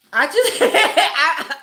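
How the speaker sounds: can't get the sentence out for laughing; breaking into laughter. Real, not acted. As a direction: giggle helplessly through the words. Confessing the cut, cracking up.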